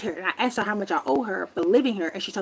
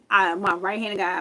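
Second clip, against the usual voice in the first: ordinary delivery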